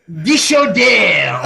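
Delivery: mock European "Dishodere", dee-sho-DARE; European-sounding pronunciation. Shown as a demonstration. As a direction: say it with a vague European accent. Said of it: "European sound".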